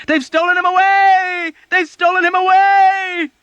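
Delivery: high shrill panicked scream. A narrator's reading. A patient screaming to the ward. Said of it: "people all over the ward began screaming".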